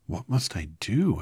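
put-on girl anxiously asking the wizard; anxious Dorothy. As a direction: ask anxiously. Dorothy asks Oz.

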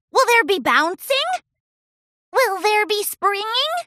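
put-on high, loud kid voice; eager filly. Sweetie Belle asking Pinkie, high eager voice. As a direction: ask hopefully. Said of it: "added Sweetie Belle".

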